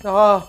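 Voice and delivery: short, loud, high scream; startled scream. Real, not acted. Player startled in the corridor, startled scream. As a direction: scream from a sudden fright.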